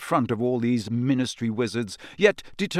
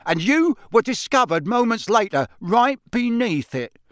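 stern official; 6 semitones higher, 3 dB louder than usual. Acted. Diggory interrogating the elf.

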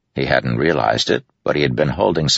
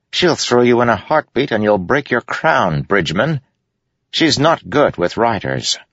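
higher, firmer stern warning; gruff horsewoman warning; 8 semitones higher than usual. A narrator's reading. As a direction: warn him off like a seasoned rider. Yennet voiced in story.

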